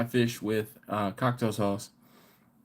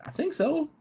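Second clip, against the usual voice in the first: mock unsure. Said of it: "And I was like".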